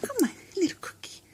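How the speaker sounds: hushed little plea; coaxing whisper. Real, not acted. Owner coaxing her dog over a cookie, coaxing whisper. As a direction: whisper it softly to coax.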